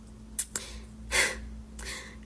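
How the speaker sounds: long weary exhale; heavy sigh. Real, not acted. Sister recalling a family conflict, heavy sigh. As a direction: let out a long, quiet sigh. Pauses upset before continuing the story.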